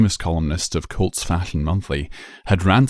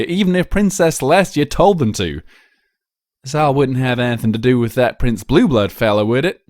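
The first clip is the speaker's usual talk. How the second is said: loud, raised no-nonsense country voice; skeptical farmer mare; 8 semitones higher, 6 dB louder than usual. Acted. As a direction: call the bluff firmly. Applejack calls out the lie.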